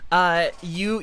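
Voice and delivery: usual speaking voice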